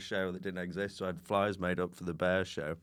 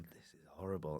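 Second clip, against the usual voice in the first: very soft, low aside; muttered audience complaint; 4 semitones lower, 11 dB quieter than usual. Retold or imitated. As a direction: grumble it under your breath. Awkward audience muttering to themselves.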